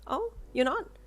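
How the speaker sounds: high-pitched caring concern. A narrator's reading. Front-desk woman checking on an upset visitor, concerned. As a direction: ask with lifted, solicitous concern. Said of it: "she asked with concern".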